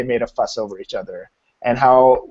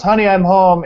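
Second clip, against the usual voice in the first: higher, louder sing-out hello; homecoming call; 7 semitones higher, 6 dB louder than usual. Retold or imitated. Husband to wife as he walks in, calling out. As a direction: call out a cheery greeting on arriving. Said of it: "walked in the door and goes".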